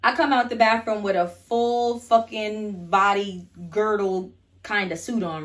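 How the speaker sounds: ordinary talk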